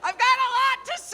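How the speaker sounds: fast, high shout. Real, not acted. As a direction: blurt it out loud and fast.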